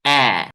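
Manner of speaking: ए pushed out loud like a retch; a loud gagging ए for the class to copy; vowel demo. Shown as a demonstration. Teacher showing the disgust sound, loud ए. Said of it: "तो क्या करते हैं?".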